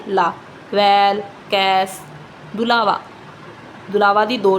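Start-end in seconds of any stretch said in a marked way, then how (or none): 0.7-2.0 ਵੈਲ and ਕੈਸ said very slowly, vowel held; vowel sign examples. Shown as a demonstration. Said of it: "ਇਹਨੂੰ ਕੀ ਬੋਲਦੇ ਆ".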